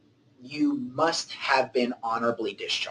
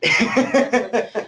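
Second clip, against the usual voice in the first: high burst of laughter; hearty laugh; 11 semitones higher, 4 dB louder than usual. Real, not acted. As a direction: laugh out loud. Laughing after Amen to that.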